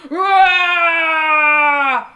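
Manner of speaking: loud, high roar; lion roar. A narrator's reading. Ogre turns into a lion.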